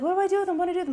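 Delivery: mock fluster, voice shooting up; frantic question. Mom panicking over the dead grasshopper, high frantic repeat.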